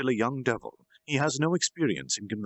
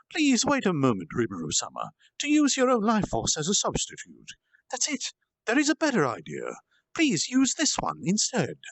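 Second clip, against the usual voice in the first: high, louder, eager demon voice; urgent pleading demon; 9 semitones higher, 3 dB louder than usual. Acted. Demon stopping his master from using his life force, urgent and high. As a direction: beg him to wait, then offer eagerly.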